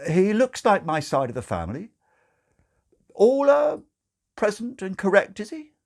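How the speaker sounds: put-on nervously checking the baby; doubtful father. Father uneasily asks the nurse about the baby.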